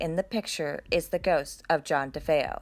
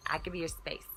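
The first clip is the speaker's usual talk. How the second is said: hushed backing off; 10 dB quieter, faster than usual. Pretend. Backing away from a ghost.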